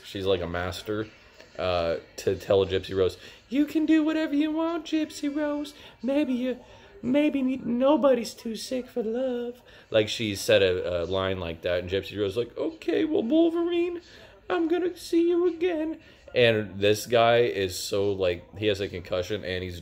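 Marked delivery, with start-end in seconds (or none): 3.5-9.6 very high, sugary coaxing voice; manipulative mom voice. Retold or imitated. 12.6-16.0 put-on very high, breathless young girl voice; girlish voice.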